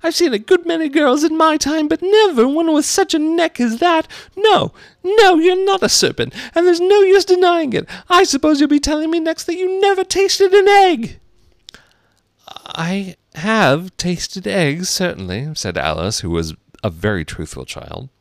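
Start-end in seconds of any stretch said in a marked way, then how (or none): 0.0-11.2 very high, loud bird rant; put-on piercing pigeon voice; scornful pigeon. Pigeon character voice. 12.5-15.5 high, very slow start; lightly voiced hesitant girl; reluctant admission. Truthful Alice admits it reluctantly.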